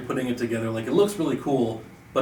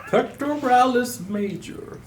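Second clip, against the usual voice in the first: pompous voice; 13 semitones higher, slower than usual. Pretend. Mock grand pronouncement.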